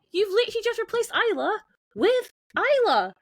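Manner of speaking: high, indignant outburst; exasperated protest. Retold or imitated. Podcaster fed up with the film's naming, high exasperation.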